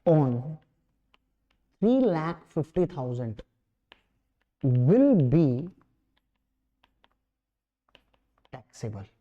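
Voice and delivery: measured dictation, one phrase at a time; drawn-out words, dropping away at phrase ends; slow dictation. Real, not acted. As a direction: leave long gaps for students writing. Dictates answer for students to write.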